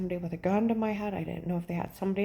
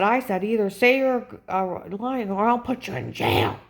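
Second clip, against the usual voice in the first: loud, higher-pitched mock yelling; agent's angry threat; 3 semitones higher, 8 dB louder than usual. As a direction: hammer out the threat like a bullying agent. Agent threatening her, retold.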